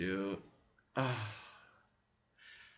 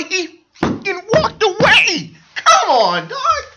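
much higher and louder, yelling in disbelief, breaking into a laugh; outraged shout; 13 dB louder, slower than usual. Real, not acted. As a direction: blow up at the screen. Ranting about the movie.

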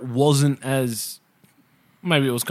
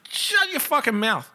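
mock snap; 11 semitones higher than usual. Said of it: "And it's like".

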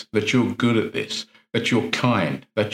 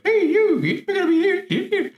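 much higher, squeaky cartoon mouse voice; Mickey Mouse voice. Shown as a demonstration. Coaching a police officer to mock his inner critic, squeaky Mickey Mouse voice. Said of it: "Make it sound like Mickey Mouse or Donald Duck".

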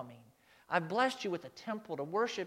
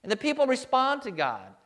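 ordinary delivery

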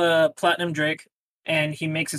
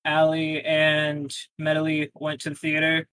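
usual speaking voice

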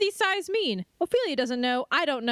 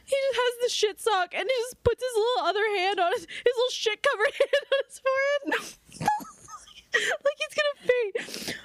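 high voice dissolving into laughs; real, breaking giggles; barely containing laughter; 8 semitones higher than usual. Real, not acted. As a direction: try to explain, then crack up. Still cracking up at image.

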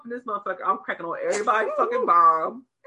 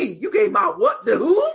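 loud, high, slow incredulous cry; hammed-up disbelief at losing her money; mock outraged disbelief; 7 semitones higher, 9 dB louder than usual. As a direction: act out the shock at the news.

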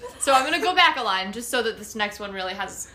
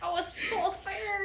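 higher theatrical wail; dramatic high afeard; 9 semitones higher than usual. Shown as a demonstration. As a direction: ham up the fear. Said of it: "repeating with more drama".

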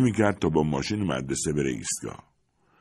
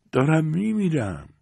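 put-on slow, high, fading old woman's voice; frail elderly patient; weak dying old woman; 10 semitones higher than usual. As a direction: say it like a woman at the end of her strength.

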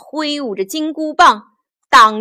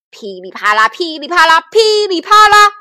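building battle clatter; 5 semitones higher, 7 dB louder, faster than usual. A narrator's reading. Storyteller imitating two fighters' weapons clashing, building louder and higher. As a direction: start at usual level, build to high and loud.

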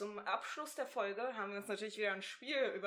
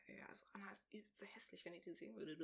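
fast, secretive whisper behind her back; gossiping whisper; 2 semitones lower, 16 dB quieter, faster than usual. Real, not acted. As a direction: whisper the gossip quickly so she can't hear. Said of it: "jetzt können wir ja richtig über Anna ablästern".

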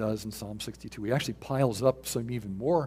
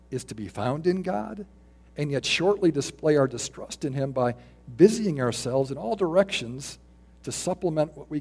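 louder, emphatic quoted reading; careful reading; 3 semitones higher, 4 dB louder than usual. Shown as a demonstration. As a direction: read the old text out with force. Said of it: "I'll say it slow".

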